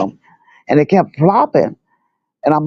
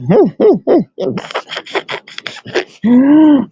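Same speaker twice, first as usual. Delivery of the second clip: helpless, breathy giggling fit mid-story; real laughing fit; 9 semitones higher than usual. Real, not acted. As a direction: burst out laughing, unable to go on. Breaks into laughter telling the story.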